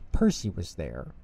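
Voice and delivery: ordinary delivery, nothing marked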